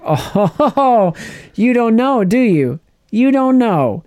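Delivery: high, gloating, laughing taunt; mocking villain taunt. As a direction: sneer it like you know her secret. Gideon mocking Bo-Katan's ignorance.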